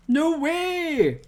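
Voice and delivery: high, loud burst of disbelief; surprised. Real, not acted. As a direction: blurt it out, caught off guard. Reacting to a chat message.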